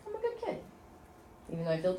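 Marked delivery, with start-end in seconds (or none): 0.1-0.6 put-on gently rising, reassuring child's tone; high soft affirmation. Shown as a demonstration. Mother tapping for her dizzy daughter, high and soft. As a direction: voice lifts high and soft on good kid. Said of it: "So I said".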